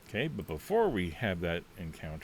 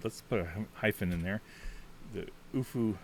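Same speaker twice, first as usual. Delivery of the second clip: ordinary talk, nothing marked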